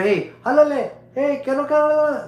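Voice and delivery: high, loud mock Mexican chatter; imitating mestizos calling out to each other; imitating Mexican street talk. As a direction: rattle it off like the guys on the corner. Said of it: "like that".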